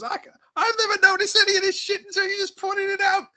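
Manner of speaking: crying with laughter. Retold or imitated. Comedian Patrice cracks up at Jim's Face Off takedown, laughing hard. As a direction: play a comedian losing it laughing at the punchline. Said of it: "like, crying, laughing".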